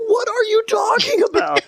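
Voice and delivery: very high, strained confused voice; retold shock at being blamed; squeaky disbelief. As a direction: squeak it out in total bewilderment. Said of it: "And I'm like".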